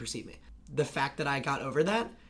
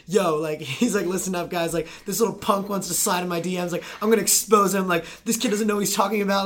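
loud, high, pumped-up callout; hyped loud callout; 5 semitones higher, 8 dB louder than usual. Retold or imitated. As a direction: call him out loud and hyped. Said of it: "he makes a video of himself and he's like".

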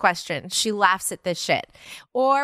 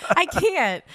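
ordinary talk, nothing marked

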